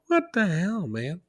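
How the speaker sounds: mock outrage at chewed glasses; exasperated outburst. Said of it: "I'm like".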